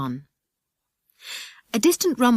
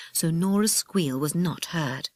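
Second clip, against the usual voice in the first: plain reading voice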